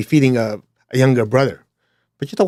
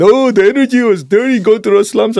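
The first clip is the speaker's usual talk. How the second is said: much higher, louder whiny mock of the complainers; 9 semitones higher, 5 dB louder than usual. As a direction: sneer it out like a spoiled whiner. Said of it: "they complained".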